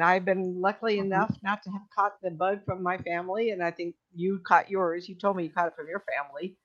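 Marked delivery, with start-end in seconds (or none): none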